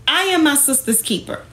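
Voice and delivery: ordinary talk, nothing marked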